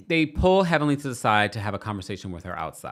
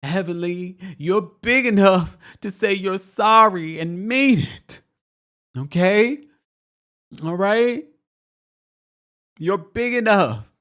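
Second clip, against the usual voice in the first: high, loud, slow tearful plea, hammed up; mock crying; 8 semitones higher, 6 dB louder, slower than usual. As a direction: imitate a melodramatic weepy preacher. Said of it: "starts crying and says".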